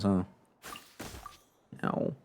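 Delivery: ordinary delivery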